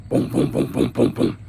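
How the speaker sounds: hard knocks on a door in a whisper; police knocking sound effect. Retold or imitated. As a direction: pound out the knocks.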